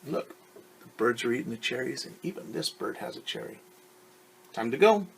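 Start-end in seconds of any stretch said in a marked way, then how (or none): none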